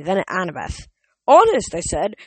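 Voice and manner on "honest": insisting. Acted. Percy insisting, voice jumps.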